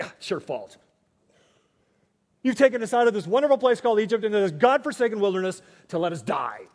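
loud, fast griping accusation; mock complaining Israelites. Israelites blaming Moses in the wilderness, hammed-up loud griping. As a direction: whine it out like a grumbling crowd. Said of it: "They stick their fingers at God and Moses".

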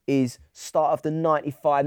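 normal talking voice

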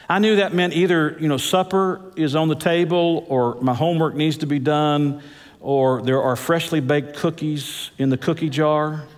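ordinary talk, nothing marked

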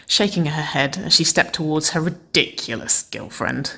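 ordinary delivery, nothing marked

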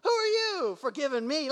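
haughty, indignant retort; high, huffy mock outrage; pompous. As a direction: act offended at being forgiven. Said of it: "one of the most pompous responses".

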